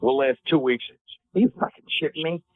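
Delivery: ordinary delivery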